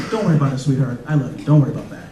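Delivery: reassuring dad. Retold or imitated. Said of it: "I was like".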